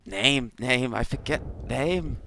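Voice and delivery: high, halting creature voice; searching insect creature in broken speech; puzzled creature. Acted. Creature asked for its name, high puzzled voice.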